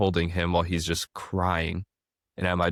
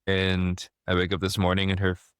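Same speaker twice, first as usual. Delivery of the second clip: normal talking voice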